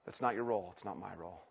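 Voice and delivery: normal talking voice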